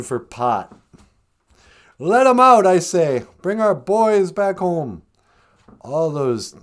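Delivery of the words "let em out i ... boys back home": loud, high opening, easing slightly; raised-voice mock demand, slowing at the end; mock rallying cry. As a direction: proclaim it like a hammed-up protest slogan. Mock rallying cry for pot prisoners.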